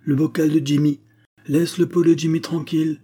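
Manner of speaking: high, loud angry warning; agitated old man's angry outburst; savage. A narrator's reading. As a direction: warn him off fiercely. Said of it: "marmonna Jacob sauvagement".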